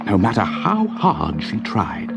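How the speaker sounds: ordinary delivery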